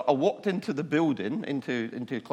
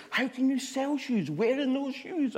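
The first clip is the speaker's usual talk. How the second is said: much higher, slow, mocking voice; scornful area manager. Area manager mocks salesman's shoes, high slow sneer. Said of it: "look at my shoes and go".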